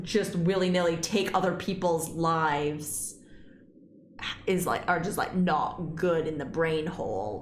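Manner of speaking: usual speaking voice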